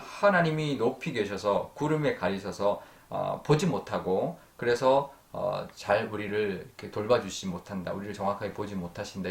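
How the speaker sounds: usual speaking voice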